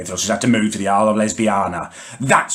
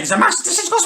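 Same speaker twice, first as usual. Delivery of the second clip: much higher squeaky take on decision, rising; mock falsetto; much faster than usual. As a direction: ape her in a silly squeak. Mocking sister's big claim.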